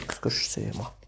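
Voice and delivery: breathless unvoiced whisper; whispered aside. Real, not acted. Tarot reader to her live viewers, whispering an aside. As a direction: say it under your breath.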